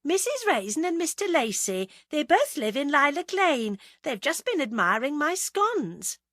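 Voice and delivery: high, chirpy cover-up; nervous wife. A narrator's reading. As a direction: introduce guests too sweetly, pitched well up. Nervous wife introduces guests to husband.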